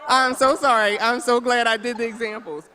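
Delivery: high, loud words through real laughter; laughing apology. Real, not acted. Speaker to a laughing audience about her examples, laughing apology. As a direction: apologize while cracking up. Laughing over the misheard word.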